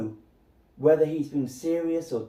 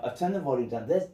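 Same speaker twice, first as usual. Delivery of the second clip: usual speaking voice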